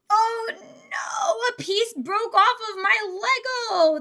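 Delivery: high, loud cry of dismay; dismayed wail. Real, not acted. Streamer sees a piece break off the Lego, dismayed wail. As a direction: wail it in alarm.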